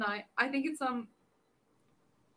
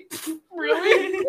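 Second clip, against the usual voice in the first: scoffing; 6 semitones higher, 11 dB louder, much slower than usual. Pretend. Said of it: "imagine I say".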